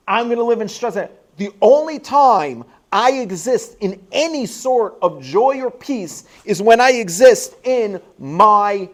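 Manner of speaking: rising pulpit intensity; preaching. Acted. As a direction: drive each point home to the congregation. Preaching with force.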